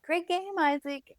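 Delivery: mom's high, bright cheer, imitated; cheery grandma. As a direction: sing it out high like a proud grandma. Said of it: "she was like".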